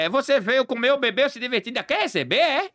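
high-pitched, incredulous telling-off; mock indignation. Acted quarrel over getting paid. (indignado, em tom de deboche)

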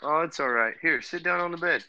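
dad checking on his upset daughter, tender. Real, not acted. Dad comforting scared little daughter.

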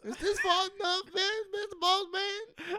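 mock servile voice. Mocking servant begging Boss Man, high pleading voice. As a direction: plead eagerly to keep the boss happy.

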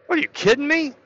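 high scoffing what; incredulous. Real, not acted. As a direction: throw it out in shocked disbelief. Outraged at policy.

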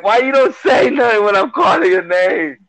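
1 exasperated scolding. Retold or imitated. Scolding retold daughter after search.

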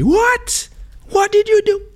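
high, loud mock outraged father; imagined angry dad. Feared dad yelling at son's news, high mock outrage. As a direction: play the dad he feared would yell. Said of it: "he's gonna tell me".

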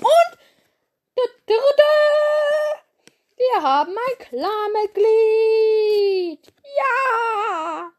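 squeaky high fanfare voice, well above her own; high excited cheer. Acted. Announcing a new clan member, high and excited.

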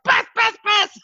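high loud calls of Beth; calling out. Retold or imitated. Running upstairs to wake his wife, calling her loudly. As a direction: shout up the stairs. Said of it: "I was like".

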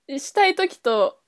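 ordinary talk

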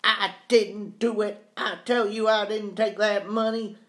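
high, insistent denial; voice rising sharply. A narrator's reading. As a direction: raise the pitch and protest. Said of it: "Robinson's voice rise sharply".

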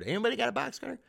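high-pitched mock plea to a room; comically high, pleading question; calling out. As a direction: ask the whole room in a squeaky pitch. Imagined asking the whole room.

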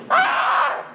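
sudden high breathy mock scream. Preacher imitating a person being killed, high breathy shriek. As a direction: let out a quick dying shriek. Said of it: "¿Qué no grita la gente cuando la matan?".

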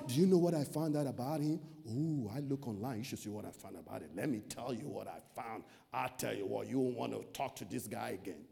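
low, hushed, fast whisper of gossip. Retold or imitated. Preacher mimics someone who googled your past, low gossiping murmur. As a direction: lean in and spill the dirt softly.

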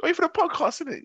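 excited recognition. Retold or imitated. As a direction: squeal like a starstruck neighbour. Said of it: "they said the same thing".

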